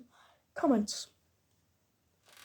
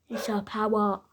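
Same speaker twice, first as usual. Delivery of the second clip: ordinary talk, nothing marked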